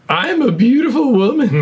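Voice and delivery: put-on high girly woman voice; hammy pretty woman; man doing a woman's voice. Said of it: "Action, pretty woman".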